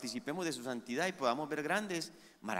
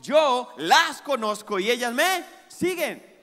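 preaching at full force; 7 semitones higher, 6 dB louder than usual. Acted. Pastor preaching to the congregation about God's voice, full force. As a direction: lift the congregation with the promise.

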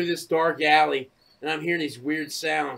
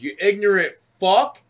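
louder, higher heckle from a car; raised-voice mock insult; retold insult; 4 semitones higher, 9 dB louder than usual. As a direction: imitate an angry passenger hurling abuse. Acts out passenger's insult.

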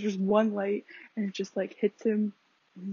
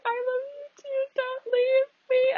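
high, wailing mock sob, pleading; hammed-up fan crying don't leave; mock crying plea; 4 dB louder than usual. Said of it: "I was crying".

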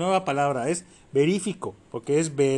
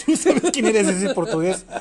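laughing; 6 semitones higher, 6 dB louder, slower than usual. Acted. Teasing call-out to listener.

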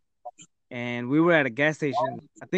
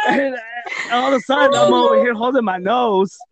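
loud laughing talk; laughing while talking; 7 semitones higher, 7 dB louder than usual. Real, not acted. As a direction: tell it through laughter. Laughing while telling the story.

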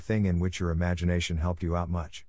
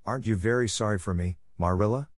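ordinary delivery

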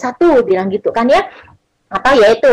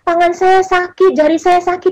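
higher-pitched mock complaining; imitated complaint; 7 semitones higher than usual. Recalling friends moaning about sore hands, imitated complaint. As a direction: mildly voice friends' aches, pitched up. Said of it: "Saya ingat teman-teman ngeluh".

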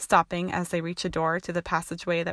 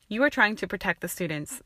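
usual speaking voice

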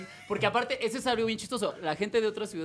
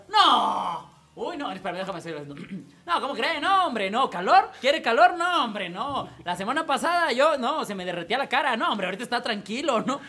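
higher, louder mock-indignant denials; proud northerner; 4 semitones higher, 5 dB louder than usual. Norteño bragging about his heat, higher and indignant. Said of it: "este orgullo que tienen los norteños por su calor".

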